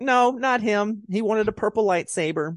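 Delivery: high, slow mock-dismissive George. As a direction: play the creator waving it off. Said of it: "George is like".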